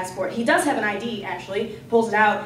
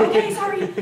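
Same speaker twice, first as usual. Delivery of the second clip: awkward laughing sorry; 10 semitones higher, 3 dB louder than usual. Retold or imitated. Awkward apology after his refusal.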